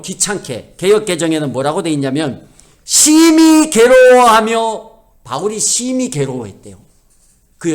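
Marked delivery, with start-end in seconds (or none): 2.8-4.9 "심히 괴로워하며" with the vowels stretched high and loud; drawn-out emphasis. Shown as a demonstration. Preacher quoting the revised Bible version, "심히 괴로워하며" drawn out high and loud. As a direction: draw out "심히 괴로워" long, high and loud.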